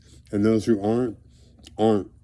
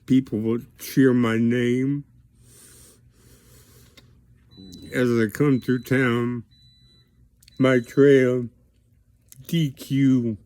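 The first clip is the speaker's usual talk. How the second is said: getting emotional; 3 semitones higher, slower than usual. Real, not acted. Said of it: "I allowed myself to get emotional".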